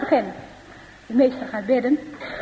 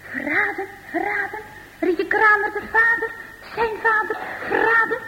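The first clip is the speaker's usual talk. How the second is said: anguished; 6 semitones higher than usual. Acted. Ab's anguished thoughts after the news.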